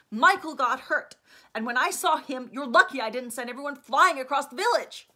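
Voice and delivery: loud, heated and defiant, slowed on key words; angry outburst. Acted. Angry Alex loudly defending herself to Stefan. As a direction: let her temper roar out. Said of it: "Her temper was roaring to life".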